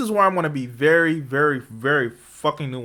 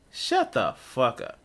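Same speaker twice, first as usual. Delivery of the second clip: imitated dismissive; 5 semitones lower, 6 dB quieter, slower than usual. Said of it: "he wanted, like".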